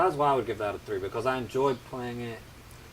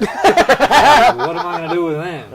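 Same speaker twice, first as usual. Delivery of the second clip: laughing; 7 semitones higher, 15 dB louder, slower than usual. Real, not acted. Joking about capturing Buddhas.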